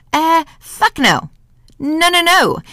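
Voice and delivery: high, drawn-out scoffing no; emphatic denial. Real, not acted. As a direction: dismiss the idea outright, pitch leaping. Emphatically answers her own question.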